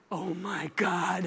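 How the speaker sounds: low, slow, hushed dismay; overwhelmed hush. Retold or imitated. Friend confiding at lunch about his new job, overwhelmed hush. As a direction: utter it slowly under your breath.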